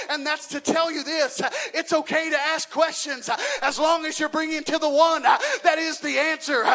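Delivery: rising sermon climax, voice swelling; preaching at full force. Real, not acted. Preacher urging the church to bring questions to God, rising to a loud cry.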